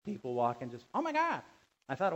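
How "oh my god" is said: high-pitched mock exclamation; imitated passerby. Preacher mimicking someone walking by at first service, high exclamation. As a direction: pitch it way up like an overheard churchgoer. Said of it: "heard one of the people walking".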